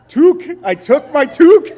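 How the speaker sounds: toque, pushed up high with glee; gleeful punchline, high and loud; excited. Real, not acted. Laughing at his toque pun.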